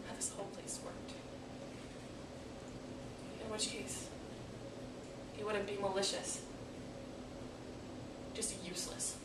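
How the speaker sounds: drained, quiet bitterness; bleak disillusionment. Acted. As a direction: let the conclusion fall softly. Monologue, bleak conclusion about God.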